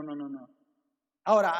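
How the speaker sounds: normal talking voice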